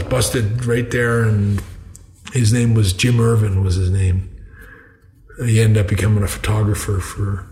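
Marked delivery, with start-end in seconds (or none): none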